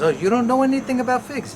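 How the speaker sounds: high-pitched imitation of an Italian lady; Italian accent. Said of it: "in this nice Italian accent".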